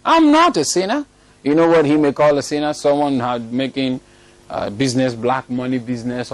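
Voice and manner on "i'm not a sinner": high, rising mock protest; indignant denial. Offended fellow denying sin to the preacher's face, indignant. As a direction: imitate an offended man, pitched right up. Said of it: "he told me".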